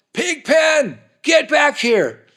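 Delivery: loud, high call across a parking lot, dragged slow; yelling. Retold or imitated. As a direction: yell for him to come back. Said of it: "So I yelled out".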